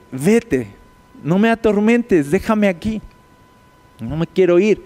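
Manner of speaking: desperate demon. Retold or imitated. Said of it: "último esfuerzo tonto".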